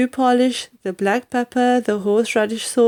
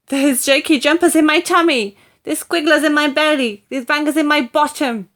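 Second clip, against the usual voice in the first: complaining grandma; 7 semitones higher, 3 dB louder than usual. Acted.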